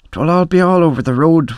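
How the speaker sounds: plain reading voice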